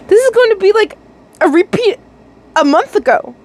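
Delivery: high, panicky; anxious. A narrator's reading. Reader's anxious continued quote.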